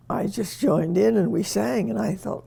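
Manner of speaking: ordinary talk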